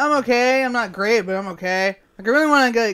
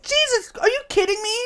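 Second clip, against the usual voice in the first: high-pitched incredulous exclamation; stunned disbelief; 12 semitones higher than usual. Real, not acted. Co-host still reeling from the revelation, stunned disbelief. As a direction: squeak it out, unable to believe it.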